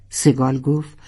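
ordinary delivery, nothing marked

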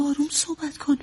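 soft, insistent plea for quiet; hushed insistence. Acted. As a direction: ask him softly to keep it down. (با اصرار ولی آهسته)

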